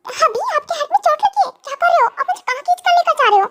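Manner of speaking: high-pitched worried questioning; alarmed wife. Acted. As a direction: question him anxiously in a high voice. Wife alarmed at husband's injury. (घबराई हुई, ऊँची आवाज़ में)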